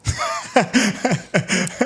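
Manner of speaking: high burst of real laughter; big laugh. Real, not acted. Laugh after teasing Frank.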